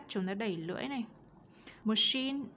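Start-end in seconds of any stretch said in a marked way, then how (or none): 1.8-2.4 "Machine" with a strong, clear sh sound; heavy SH in "Machine". Shown as a demonstration. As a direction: say "Machine" higher and louder, leaning on the sh. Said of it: "S âm S trở nặng này". ("Machine" phát âm sh nặng, rõ)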